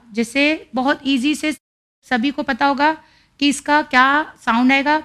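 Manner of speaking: ordinary delivery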